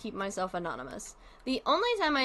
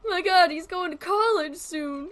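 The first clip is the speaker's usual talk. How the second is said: mock tearful parent; 7 semitones higher, 5 dB louder than usual. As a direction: gush like a weepy proud mom. Mock proud parent tearing up.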